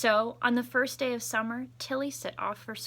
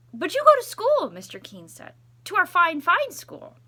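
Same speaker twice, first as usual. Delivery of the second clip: much higher, louder protesting voice; 10 semitones higher, 12 dB louder than usual. Acted. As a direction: squeak out the objection. Principal protests to Tilly.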